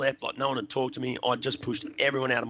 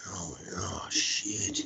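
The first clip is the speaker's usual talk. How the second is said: hushed, whispered realisation; low, breathed-out mock horror; dawning dread; 4 semitones lower, 5 dB quieter, much slower than usual. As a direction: let it sink in under the breath. Sudden realisation of his mistake.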